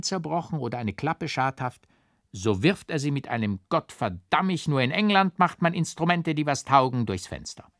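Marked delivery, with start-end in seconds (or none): 3.7-7.0 a raised, higher-pitched curse at the broken flute; grumbling curse. A narrator's reading. Flute player curses his instrument, mock angry. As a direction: spit out the oath at England's rival instruments.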